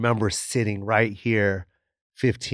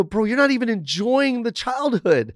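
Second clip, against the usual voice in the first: exasperated telling off; 10 semitones higher than usual. Retold or imitated. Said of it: "I go".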